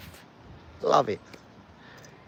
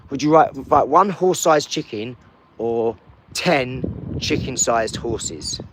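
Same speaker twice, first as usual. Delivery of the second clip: louder, with chicken-sized drawn out slowly; emphatic correction; 5 dB louder, slower than usual. Shown as a demonstration. Man corrects the mixed-up question, slowly stressing chicken-sized horses. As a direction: spell out the right version. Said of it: "you mean, yeah?".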